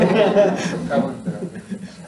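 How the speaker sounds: rising amused laugh; short bright laugh. Real, not acted. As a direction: laugh at the absurdity. Laughs at the feud.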